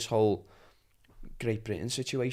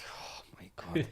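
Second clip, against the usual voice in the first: whisper; 6 semitones higher, 6 dB quieter, slower than usual. Retold or imitated. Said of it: "I'm just like".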